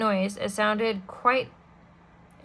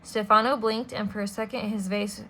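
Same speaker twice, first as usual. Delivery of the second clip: ordinary delivery, nothing marked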